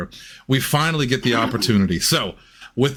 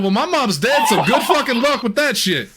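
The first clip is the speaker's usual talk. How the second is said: laughing comeback; 9 semitones higher than usual. Retold or imitated. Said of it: "And I said".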